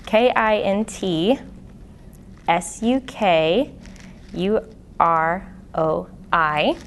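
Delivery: slow, letter by letter, each letter separate; spelling it out. Shown as a demonstration. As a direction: spell out K I N T S U K U R O I slowly with pauses. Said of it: "I'll say it again".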